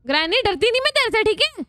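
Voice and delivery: high, cocky challenge; defiant taunt. Real, not acted. Gamer taunting Granny, high and defiant. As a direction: dare her to try.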